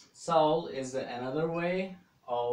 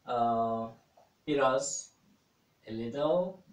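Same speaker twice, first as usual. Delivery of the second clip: usual speaking voice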